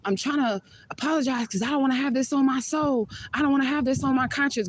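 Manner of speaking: high, mocking voice of a pleading date; retelling the date's apology in a mocking high voice; imitated yelling. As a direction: squeak out his desperate apology. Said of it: "he was yelling".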